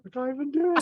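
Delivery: laughing squeal. Real, not acted. Player cracking up at his own bad singing, laughing squeal.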